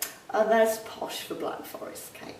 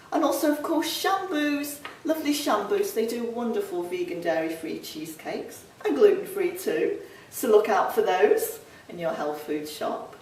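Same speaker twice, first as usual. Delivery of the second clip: ordinary talk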